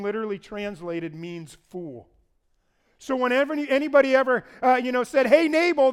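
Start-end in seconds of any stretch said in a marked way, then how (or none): 5.3-5.9 loud, high mock hail; shouted call. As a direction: call out like heckling someone. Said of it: "they're shouting".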